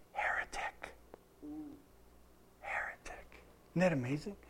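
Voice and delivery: hushed, voiceless reveal; dramatic whisper. Shown as a demonstration. Preacher revealing the Greek word to the congregation, dramatic whisper.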